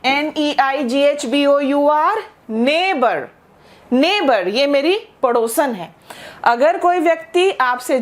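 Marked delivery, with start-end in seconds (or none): 2.5-4.5 नेबर said as nay-ber, the gh silent; नेबर, clearly, twice, the gh silent; teacher showing how to say नेबर, nay-ber; pronunciation of neighbor. Shown as a demonstration. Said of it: "इस शब्द का उच्चारण क्या है?".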